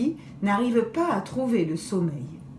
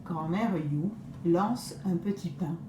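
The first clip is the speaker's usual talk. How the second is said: ordinary delivery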